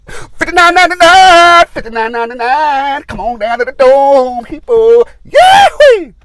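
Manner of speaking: whooping announcer, full hype; announcer shouting price. Retold or imitated. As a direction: blast the price like a carnival barker. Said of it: "he goes".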